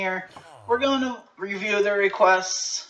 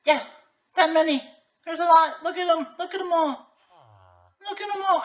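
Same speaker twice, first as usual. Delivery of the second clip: excited; 11 semitones higher, faster than usual. Real, not acted. Showing crowds of zombies.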